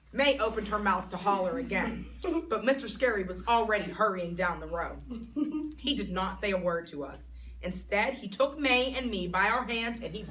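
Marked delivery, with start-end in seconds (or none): none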